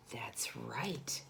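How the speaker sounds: soft approving whisper. A narrator's reading. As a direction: confide it to the kids. Whispered confirmation to children.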